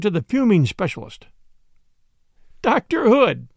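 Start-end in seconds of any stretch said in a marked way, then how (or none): 2.6-3.5 higher-pitched, eager call of a name; enthusiastic cry. A narrator's reading. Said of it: "he cried enthusiastically".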